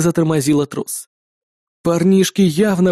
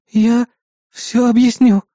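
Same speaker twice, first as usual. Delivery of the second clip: higher-pitched contrite voice; guilty; 5 semitones higher, slower than usual. A narrator's reading. Partner owns up to boss, guilty. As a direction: offer an apologetic explanation. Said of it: "виновато опустив взгляд".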